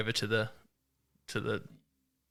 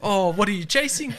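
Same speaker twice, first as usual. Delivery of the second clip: imitated Kiwi, high and louder; keen New Zealander; 9 semitones higher, 5 dB louder than usual. New Zealand local quizzes visiting hunter, mock-keen Kiwi. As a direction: put on an eager local's raised voice. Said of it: "they're like".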